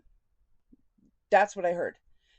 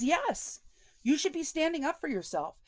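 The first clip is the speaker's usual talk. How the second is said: pushy insistence; 7 semitones higher than usual. Retold or imitated. Quoting therapist urging her.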